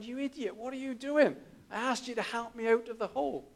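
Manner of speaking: high-pitched, incredulous telling-off; a hint of mock outrage at the friend; exasperated scolding. Man scolds friend who jumped in.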